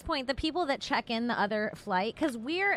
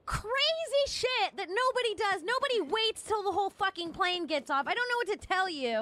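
high-pitched retold rant; mock yelling; 9 semitones higher than usual. Woman retelling her argument over plane rows, mock yelling. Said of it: "Like, yelling".

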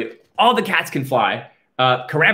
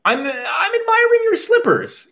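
mock mutter. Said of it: "muttered Caramba".

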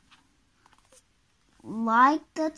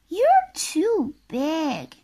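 Miss Bossy; 4 semitones higher, 4 dB louder, slower than usual. Acted. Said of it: "she cried".

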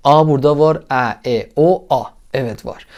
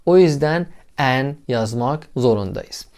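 ordinary delivery

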